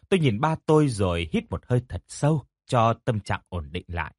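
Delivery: plain reading voice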